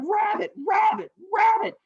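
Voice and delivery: high, loud racing chant; frantic rabbit. Acted. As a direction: yelp it urgently as a sprinting rabbit. Rabbit races to catch up.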